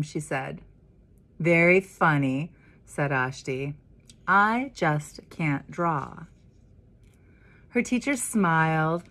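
put-on lively read-aloud for children; story narration. A narrator's reading. Minister reading The Dot to the congregation, lively storytelling. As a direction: read it like a picture book to kids. Reads picture book to children.

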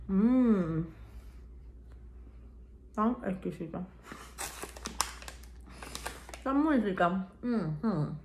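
talking with mouth full; eating chocolate while talking. Real, not acted. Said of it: "a ver cómo hablo con la boca llena de chocolate".

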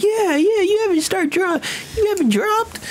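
very high squeaky mocking voice; mock fan voice. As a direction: pipe up like a whiny fan.

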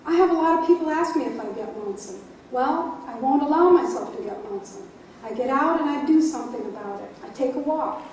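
emphatic and loud, declaring it outright; firm proclamation. Real, not acted. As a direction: declare it proudly to the microphone. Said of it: "She proclaims this directly into the microphone".

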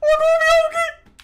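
very high, loud holler; piercingly high shouted question; high-pitched yell. Pretend. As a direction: yell it at the top of your voice. Calling again about the door.

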